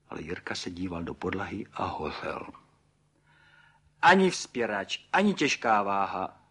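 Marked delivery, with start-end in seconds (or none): 4.0-6.4 exclaiming. A narrator's reading. Pan Burgán corrects the guest about the model, exclaiming. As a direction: cry out the correction with pride. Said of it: "zvolal pan Burgán".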